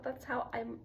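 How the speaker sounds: ordinary talk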